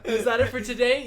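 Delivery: cheery closing question; hyped order-taker. Retold or imitated. Cashier wrapping up the order, laughing and hyped. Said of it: "extra as fuck".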